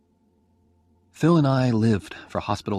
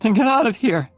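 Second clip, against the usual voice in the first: much higher, loud angry order; dying girl angrily sends Oliver away; 13 semitones higher, 10 dB louder than usual. A narrator's reading. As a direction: fling him out in a burst of anger. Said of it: "she said angrily".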